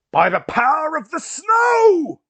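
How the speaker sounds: high, drawn-out heroic bellow; battle cry. Acted. Player voices Kara charging with snowballs, yelling a battle cry. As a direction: roar it out slowly at full force.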